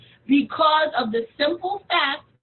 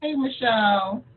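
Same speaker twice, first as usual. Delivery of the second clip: low, downbeat greeting; unexcited; 4 semitones lower than usual. Shown as a demonstration. Coach showing the wrong tone for calling Michelle, glum. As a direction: greet her with no energy at all. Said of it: "watch our tone when we're speaking".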